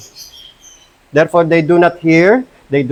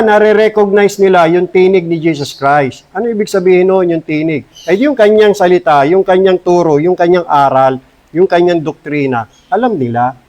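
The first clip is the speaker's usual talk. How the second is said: normal talking voice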